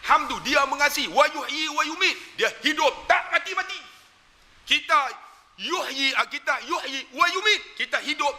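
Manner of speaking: high, impassioned sermon voice; fervent preaching. Acted. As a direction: preach it with rising fervour. Preaching at full force. (ceramah penuh semangat dengan suara tinggi)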